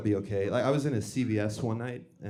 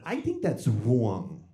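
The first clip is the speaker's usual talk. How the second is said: slow, heavy New York mother; New York "wrong", vowel rounded to "wrawng"; mom's New York accent; much slower than usual. Retold or imitated. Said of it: "She has a New York accent".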